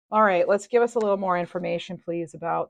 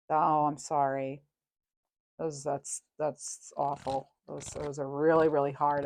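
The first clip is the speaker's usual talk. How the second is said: sympathetic; 3 semitones lower, 4 dB quieter, slower than usual. Real, not acted. Pet loss sympathy.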